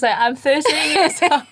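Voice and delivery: high, slow learner's recital; slow high-pitched mock answer, laughter close behind; botched answer re-enacted. Retells her botched answer, laughing.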